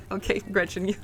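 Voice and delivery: ordinary talk, nothing marked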